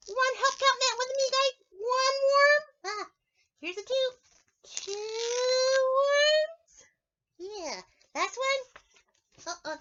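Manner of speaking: squeaky eager spider; playful spider puppet for kids; friendly spider puppet. Acted.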